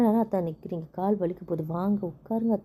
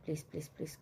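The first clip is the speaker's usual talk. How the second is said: much softer pleading please; soft begging; 2 semitones lower, 14 dB quieter than usual. Acted. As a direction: beg him under your breath. Wife begs husband to forgive. (மெல்லிய கெஞ்சல்)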